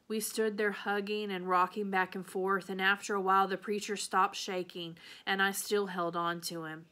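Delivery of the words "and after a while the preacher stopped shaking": ordinary delivery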